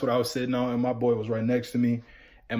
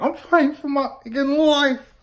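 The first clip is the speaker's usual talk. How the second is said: much higher strained meme voice; R. Kelly meme; 12 semitones higher, 4 dB louder than usual. Retold or imitated. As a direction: squeeze it out high and desperate. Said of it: "y'all know that one meme".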